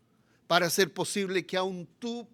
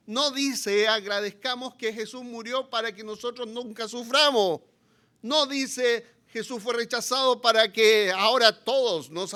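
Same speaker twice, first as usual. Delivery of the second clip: higher, louder impassioned preaching; fiery preaching; 4 semitones higher, 7 dB louder than usual. Acted. Preacher pleading with the congregation, fiery. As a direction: pound the point home to the congregation.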